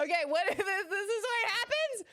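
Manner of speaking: high, giddy squeal through laughter; laughing disbelief. Real, not acted. Streamer amazed at what happens, laughing in disbelief. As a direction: exclaim it loudly while laughing.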